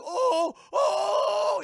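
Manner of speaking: high, shouted "oh"; rising horrified exclamation; shocked cry. Retold or imitated. Patient sees robot body in mirror, shocked cry.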